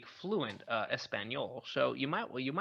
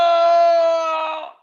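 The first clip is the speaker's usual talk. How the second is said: a sudden loud, high cry of "Oh!" as if struck; mock heart attack shout; 10 semitones higher, 11 dB louder than usual. Mock heart attack on hearing her name, a sudden high loud cry. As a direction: yelp out like the heart gave out. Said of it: "Did you have another heart attack?".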